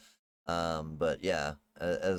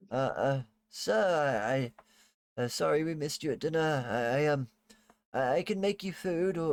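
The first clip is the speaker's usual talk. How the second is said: nervous maid; 5 semitones higher than usual. Acted.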